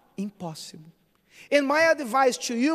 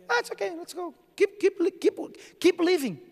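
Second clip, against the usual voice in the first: breezy higher voice with a laugh; mock offhand Jesus; 8 semitones higher, faster than usual. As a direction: toss it off as if it were nothing. Mock casual Jesus leaving.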